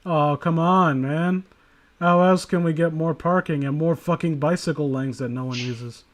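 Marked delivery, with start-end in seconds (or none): none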